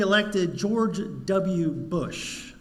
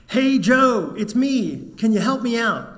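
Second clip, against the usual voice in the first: higher, louder hail to a friend; calling out; 5 semitones higher, 6 dB louder than usual. Acted. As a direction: call up to a pal for help. Trapped man calls to friend.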